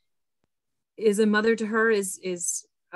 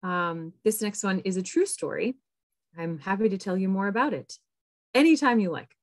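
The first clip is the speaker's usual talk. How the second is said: usual speaking voice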